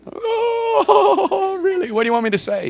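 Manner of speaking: high, drawn-out groan of protest; reluctant whine. Acted. Believer balking at God's errand, reluctant whine. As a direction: whine like you dread the errand.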